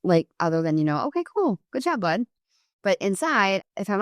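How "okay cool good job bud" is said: much higher, bright forced-casual praise; cheery outward praise. Retold or imitated. Mom praises son while secretly annoyed, high cheery voice. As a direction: hide annoyance behind upbeat praise. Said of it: "I didn't outwardly say anything".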